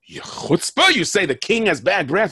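high, loud indignant scolding; lion king scolding the goat. Acted. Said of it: "He goes".

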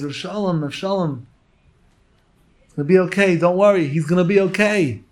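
lady on the balcony reassuring the rabbi; reassuring call. Retold or imitated. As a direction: call down to soothe him. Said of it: "calls out and says".

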